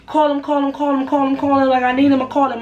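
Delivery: pleading for him. Retold or imitated. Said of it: "I remember me crying for him".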